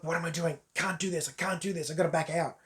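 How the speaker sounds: hushed, worried muttering to himself; softly imitating an anxious man backing out; panicked self-talk. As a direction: lightly act out a man losing his nerve. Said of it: "he's literally going like".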